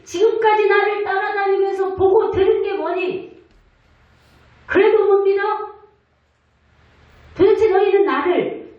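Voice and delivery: Jesus rebuking. Acted. Jesus rebuking frightened disciples in the boat, scolding. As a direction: scold the disciples for their fear. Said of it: "제자들에게 꾸짖으셔요".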